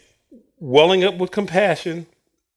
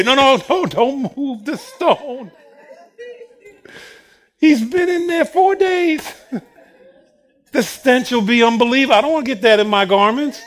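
much higher, fussy mock objection with laughter; hammed-up protesting Martha; 7 semitones higher than usual. Martha refusing to open the tomb, high-pitched mock protest. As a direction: whine it out, horrified at the smell.

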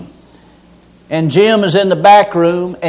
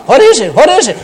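high, louder impatient question; exasperated reply; 8 semitones higher, 6 dB louder, faster than usual. Acted. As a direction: respond testily to being called repeatedly. John answers repeated pointless calls.

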